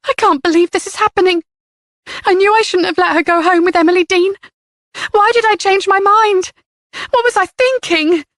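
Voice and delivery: high, tearful disbelief. A narrator's reading. Mother of missing girl, tearful and high. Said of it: "her eyes filled with tears".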